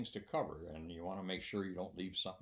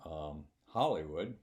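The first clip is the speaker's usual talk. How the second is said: ordinary delivery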